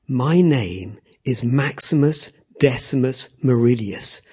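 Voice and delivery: slow, measured declaration of identity; Maximus. Acted. Unmasked gladiator revealing himself to the emperor, slow and resolute. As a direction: state each name deliberately, with weight. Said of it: "slowly turning to the emperor, and he goes".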